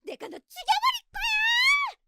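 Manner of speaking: extremely high screech, last words drawn out; furious screaming. Acted. Sister-in-law grabbing her elder's hair, screaming in rage. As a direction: scream a threat in a wild rage. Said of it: "소리를 지르며 제 머리채를 잡았어요".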